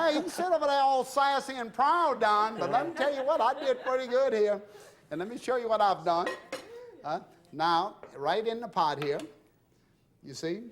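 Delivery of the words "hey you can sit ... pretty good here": higher and louder, laughing while bragging; laughing tease. Real, not acted. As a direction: tease Don and brag through laughter. Teasing a guest on set.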